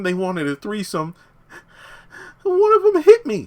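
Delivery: high-pitched mock victim's account; put-on high female voice, building to an outcry; Dina. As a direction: imitate a woman pleading her case, ending in a loud high cry. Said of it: "she could easily flip the narrative".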